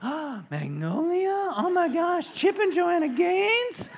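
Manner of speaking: high, loud mock gasp of fangirl delight; gushing ladies. Ladies of the house reacting to the famous employer, hammed-up gushing. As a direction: imitate starstruck women squealing in drawn-out excitement. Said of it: "All the ladies in the house are like".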